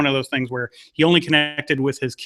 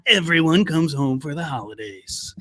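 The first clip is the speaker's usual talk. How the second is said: lightly done movie trailer announcer; a hint of the epic trailer narrator; cheesy trailer voice. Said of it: "isn't that the cheesy trailer voice".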